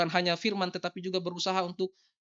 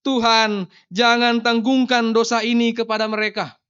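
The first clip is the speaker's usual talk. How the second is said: calling out loudly in prayer; a loud pleading cry to God; 2 semitones higher, 8 dB louder than usual. Acted. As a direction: cry it aloud to heaven, kneeling. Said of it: "ia berseru dengan suara nyaring".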